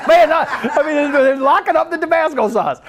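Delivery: high, laughing disbelief. Real, not acted. Pastor laughing that the sauce is locked up. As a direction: talk through the laughter.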